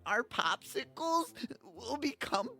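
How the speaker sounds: high, loud melodramatic wail; tearful elf. A narrator's reading. Elf warns knight of melting snacks, wailing. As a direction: sob through a list of calamities. Sobbing elf lists the disasters.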